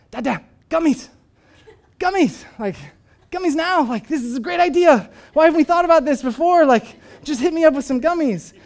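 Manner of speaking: put-on high, loud, eager little-kid begging; excited toddler. Son begging dad for gummies, high excited kid voice. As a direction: beg like a giddy two-year-old. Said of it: "and he goes".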